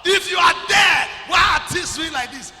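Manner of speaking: high, loud, slow wail at the Lord; mock desperate prayer. Preacher imitating a complaining believer shouting to God. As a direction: wail the complaint up to heaven, hammed up. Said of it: "Another thing say".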